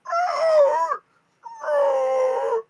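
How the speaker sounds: very high, loud wyrm screech; piercing creature cry; large dragon cry. Acted. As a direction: wail like a dragon yanked by its chain. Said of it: "makes a large cry".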